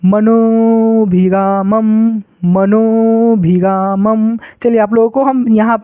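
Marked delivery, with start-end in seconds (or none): 0.0-4.4 मनोभिरामम held long and slow; slow drawn-out reading. Shown as a demonstration. Teacher showing the held reading with avagraha. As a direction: stretch मनोभिरामम, lingering on each syllable. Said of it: "थोड़ा उच्चारण करके पढ़ेंगे".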